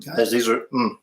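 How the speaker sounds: talking through a mouthful; words round a bite of food; mouth full. Real, not acted.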